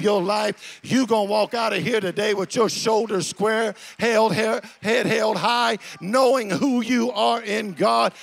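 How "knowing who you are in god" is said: ordinary delivery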